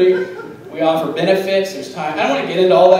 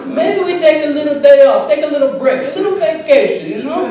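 high, lazy toaster voice, pitched way up; toaster's voice; 8 semitones higher than usual. Acted. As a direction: play a sleepy toaster begging a day off. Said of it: "And it said".

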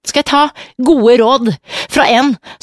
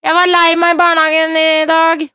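put-on much higher small child voice; imitating little girl; 7 semitones higher than usual. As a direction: play the little girl telling her sad news. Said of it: "Så sier hun".

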